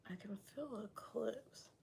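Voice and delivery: whispered aside while working; voiceless whisper to herself. Real, not acted. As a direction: murmur it under her breath, focused. Muttering to herself mid-styling.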